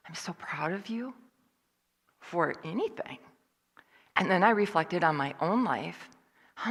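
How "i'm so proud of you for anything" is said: hushed, whispered praise; soft unvoiced murmur of pride; tender whisper. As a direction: whisper it close, like to a child. Imagining someone saying it tenderly.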